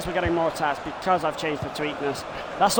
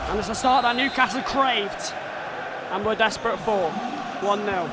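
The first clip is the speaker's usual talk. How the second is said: a bit higher, measured pundit read; commentary replay analysis; 5 semitones higher than usual. Acted. Gamer analysing his goal replay, commentator voice. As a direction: talk through the goal replay.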